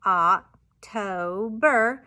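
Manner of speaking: "October" slowly split into oc, to, ber, loud; syllable-by-syllable "October" with gaps; chopped syllables. Shown as a demonstration. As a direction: chop "October" into three separate, firm beats. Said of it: "So they would chop October".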